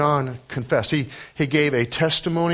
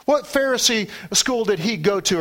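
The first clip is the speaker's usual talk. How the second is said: ordinary delivery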